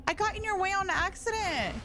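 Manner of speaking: very high, pleading squeal; high-pitched protest. Real, not acted. Player got in the way on accident, squealed protest. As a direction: protest your innocence way up high.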